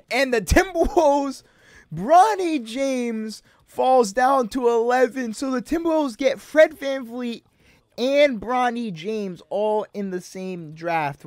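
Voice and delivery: high, loud, drawn-out announcer call; big, stretched-out hype call; excited draft announcement. Real, not acted. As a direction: proclaim the shock pick slowly and loudly. Announcing a huge draft surprise.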